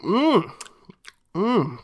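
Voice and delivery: usual speaking voice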